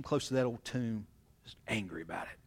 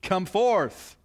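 resounding King James command, higher and louder; grand command; 6 semitones higher, 6 dB louder than usual. Acted. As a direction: boom it out like an old Bible reading. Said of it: "it sounds good in the King James".

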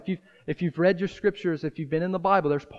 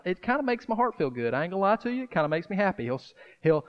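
usual speaking voice

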